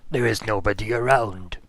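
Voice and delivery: a hint of the necromancer voice; Cadfael voice. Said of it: "he's like".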